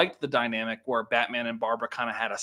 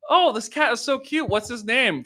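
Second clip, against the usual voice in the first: much higher, gushing over a cute cat; cooing passerby; 11 semitones higher, 4 dB louder than usual. Pretend. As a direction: gush in a high mock voice.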